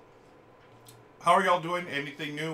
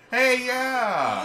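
excited; 13 semitones higher, 12 dB louder than usual. Real, not acted. Sudden excited realization.

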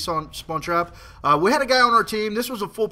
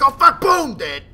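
sudden panic; 8 semitones higher, 8 dB louder, slower than usual. Retold or imitated.

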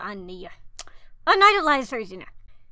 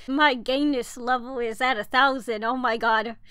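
normal talking voice

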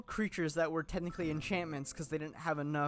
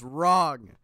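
loud, drawn-out contradiction; emphatic rebuttal; 12 dB louder than usual. Real, not acted. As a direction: burst out loudly to shut the idea down. Rebutting a common saying emphatically.

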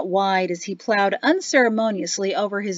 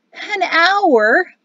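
high-pitched whiny wail; child's dismayed whine; soft wail; 9 semitones higher, 6 dB louder than usual. A narrator's reading. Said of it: "Peyto wailed softly".

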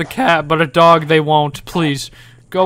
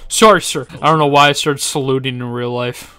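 ordinary talk

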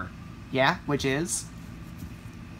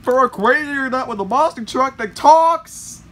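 loud, higher-pitched rant; exasperated yell; 7 semitones higher, 7 dB louder than usual. Acted. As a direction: yell your complaint at full voice. Ranting at monster truck.

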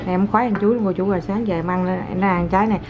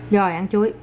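ordinary talk, nothing marked

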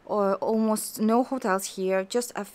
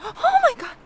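high, loud squeal of delight; amazed; 7 dB louder than usual. Real, not acted. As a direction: gasp then squeal it out. Delighted shock at squirrel sight.

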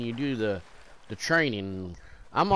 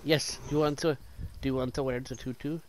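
lightly put-on Russian ballet teacher; Russian accent; 2 semitones lower, 6 dB quieter than usual. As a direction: answer with a hint of Russian accent. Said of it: "You sound like a Russian ballet teacher".

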